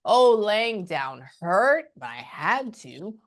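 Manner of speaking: slow, drawn-out retelling; a light imitation of a mother in pain; slow, strained mother. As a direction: drag it out as if every move hurts. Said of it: "That's how she sounds in my brain".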